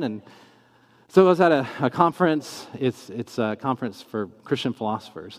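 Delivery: ordinary delivery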